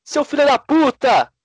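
high, loud crowd heckle; mock heckling. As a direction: yell abuse like a football fan, slowing on the final insult. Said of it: "Pode falar".

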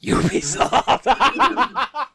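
high, loud, drawn-out "Ubisoft"; stretched mock echo breaking into a laugh. Real, not acted. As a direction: burst into laughter on the word. Mocking echo while cracking up.

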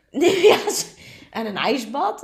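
laughing squeal; high laughing burst. Real, not acted. Bursting out laughing over ijsbad.

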